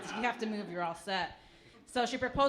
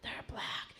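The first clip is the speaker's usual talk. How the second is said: gossipy whisper; whispered aside; 7 dB quieter than usual. Retold or imitated. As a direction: drop to a secretive whisper. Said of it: "And they're like".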